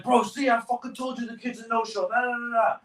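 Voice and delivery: mock the boss saying I told you; imitating a smug gunny, pitch way up; gloating gunny.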